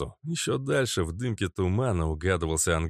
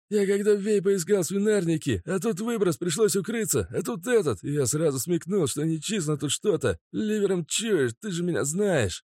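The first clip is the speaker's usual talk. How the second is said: nervous gabbling; 11 semitones higher than usual. Acted. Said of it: "Запинаясь, начал тараторить Мазут".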